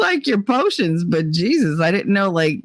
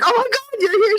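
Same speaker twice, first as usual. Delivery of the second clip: excited greeting; 12 semitones higher than usual. Retold or imitated. Comedian voiced greeting fan.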